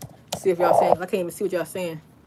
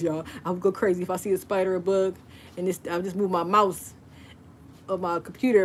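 usual speaking voice

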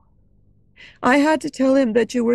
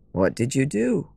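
Raoul's voice; 9 semitones lower, 3 dB quieter than usual. A narrator's reading. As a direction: lower male voice for Raoul. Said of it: "Raoul asked".

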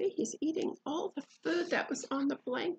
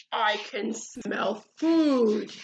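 slow, low, loud bear yell; 3 semitones lower, 6 dB louder, slower than usual. Acted. Hungry bear calling out from nearby, yelled. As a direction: boom it out slowly and low. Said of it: "yelled a voice from nearby".

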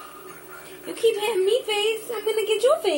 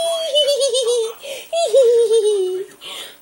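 high delighted laugh; delighted laughter; 4 semitones higher, 4 dB louder than usual. Real, not acted. Laughing with baby.

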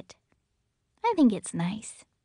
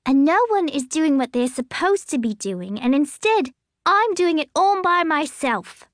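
higher and louder, climbing in indignation; upset girl shouting; 6 semitones higher, 6 dB louder than usual. A narrator's reading. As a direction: near tears, no one listens. Girl complains, parents laughing.

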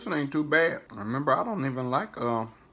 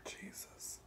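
breathless whisper of dread; 22 dB quieter than usual. Real, not acted. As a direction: whisper it to yourself. Dreading eating the cornbread.

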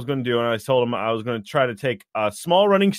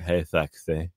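seductive line; 10 semitones lower, 6 dB quieter, slower than usual. Acted. Flirting in character at dwarf.

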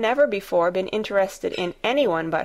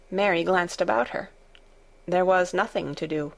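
plain reading voice

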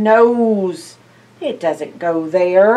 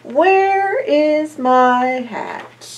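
slow, higher searching question; searching Santa; 7 semitones higher, much slower than usual. Acted. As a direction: call out slowly for the hat. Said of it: "started to look".

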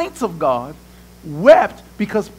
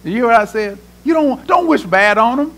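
usual speaking voice

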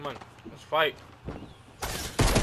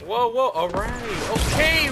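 panicked whoa; 8 semitones higher, 5 dB louder than usual. Real, not acted. Calming panic in a fight.

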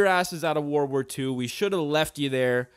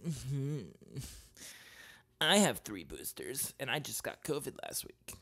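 smug person; 10 dB quieter than usual. Retold or imitated. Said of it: "they're just like".

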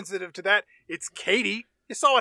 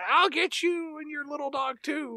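villain's threat; 9 semitones higher than usual. Acted. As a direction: draw out a high mock threat. Mimicked film villain's parting threat.

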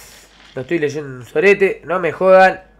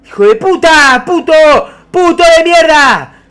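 high, loud angry swearing; furious cursing; 11 semitones higher, 9 dB louder than usual. Real, not acted. Gamer yelling curses at the madman. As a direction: curse the enemy out in a rage.

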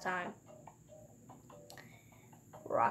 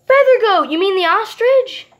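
excited shout; 7 semitones higher, 7 dB louder than usual. Real, not acted. New goat unlocked.

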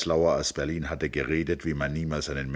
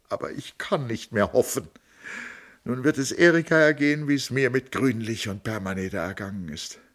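higher, pleading, rising to a cry; lamenting sister, higher and tearful; 7 semitones higher, 5 dB louder than usual. Acted. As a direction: plead her case through tears. Tony's tearful lament to Tom.

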